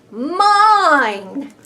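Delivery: loud possessive cry, rising; shouted. Acted. Greedy bee shouting that the flower is his. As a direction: claim it loudly. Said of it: "he shouted".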